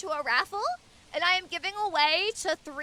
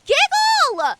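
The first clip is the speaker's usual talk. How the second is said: excited squeal; 10 semitones higher, 8 dB louder, much slower than usual. Real, not acted. Squeals thanks for gifted subs.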